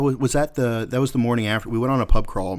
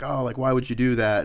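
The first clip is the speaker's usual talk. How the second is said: ordinary delivery, nothing marked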